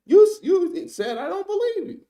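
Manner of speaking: high, loud mock voice of the wife. Commentator voicing the wife quoting the woman, high mock voice. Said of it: "the wife said".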